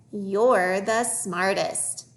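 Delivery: very slow, stretched words; slow reading model. Shown as a demonstration. Teacher modelling a phrase for young readers, slowly. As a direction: draw each word out for learners.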